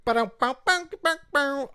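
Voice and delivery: high sung bass riff; bass noises. Shown as a demonstration. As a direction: hum a bass line transition. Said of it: "do the transition noises".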